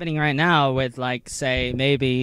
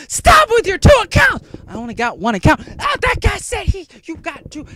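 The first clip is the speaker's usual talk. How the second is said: high, loud mocking squeal; mocking gullible follower; 13 semitones higher, 9 dB louder than usual. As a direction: parody an overexcited yes-man in a high voice. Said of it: "you're, you're like".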